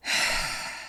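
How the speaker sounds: long, weary, exaggerated breath out; dramatic sigh. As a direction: heave a big theatrical sigh. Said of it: "I legit just wrote down, "Big sigh".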